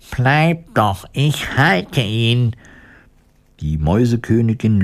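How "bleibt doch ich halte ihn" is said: drawn-out, higher call without shouting. A narrator's reading. Woodcutter to fleeing mice, a mild call. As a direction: stretch the words out to those fleeing. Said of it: "rief den Mäusen zu".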